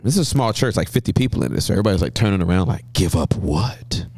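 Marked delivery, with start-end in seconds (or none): 2.9-4.1 puzzled hushed question. Retold or imitated. Puzzled congregation turning round, acted.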